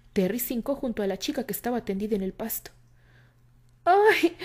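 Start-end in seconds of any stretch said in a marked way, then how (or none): 3.8-4.4 high, loud pained cry. A narrator's reading. Candy wakes and cries out in pain, high. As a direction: yelp in pain. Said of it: "Candy gritó ... dolor".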